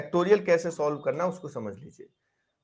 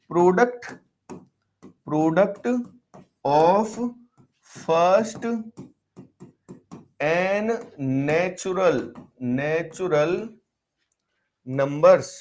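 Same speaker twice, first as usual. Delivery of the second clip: word by word with long pauses; slow dictation; much slower than usual. Real, not acted. Teacher dictating a definition for students to write, slow dictation. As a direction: dictate slowly, leaving gaps between words.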